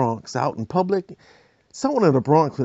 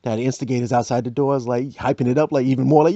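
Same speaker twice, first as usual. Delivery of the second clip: ordinary delivery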